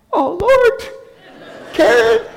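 very high, loud comic wail; mock dismay. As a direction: wail it in hammed-up dread. Mock dismay at seeing Karen.

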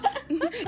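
high giggle; laugh. Real, not acted. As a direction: giggle brightly. Laughing at own story.

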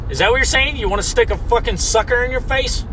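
normal talking voice

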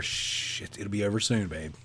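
mock soothing hush, soft and low; hushing husband. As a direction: play a husband quietly shushing his wife. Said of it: "here comes Jim leaning over".

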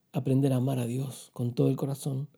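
ordinary talk, nothing marked

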